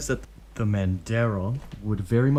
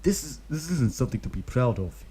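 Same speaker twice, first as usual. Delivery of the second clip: lightly voiced grim ninja; 4 semitones higher than usual. A narrator's reading. Obito scolding the kid, mildly grim. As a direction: say it darkly, not proud. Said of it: "he says grim".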